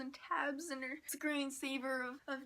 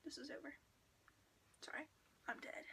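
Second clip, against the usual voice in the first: hushed secret; 3 semitones higher, 9 dB quieter, faster than usual. Retold or imitated. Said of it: "he was like".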